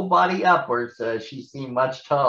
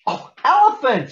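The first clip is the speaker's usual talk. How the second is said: much higher, louder guess; excited shout; 6 dB louder than usual. Acted. Hannah shouting her guess at Elmo's elephant. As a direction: blurt it out with delight. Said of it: "shouted Hannah".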